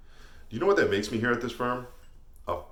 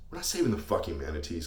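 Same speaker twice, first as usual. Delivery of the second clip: ordinary delivery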